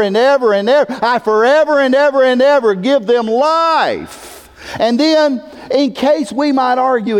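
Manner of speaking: high, forceful pulpit delivery; impassioned preaching. Real, not acted. Preacher insisting eternal means forever, impassioned. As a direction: drive the point home with rising fervor.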